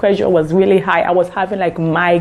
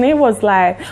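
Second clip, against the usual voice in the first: normal talking voice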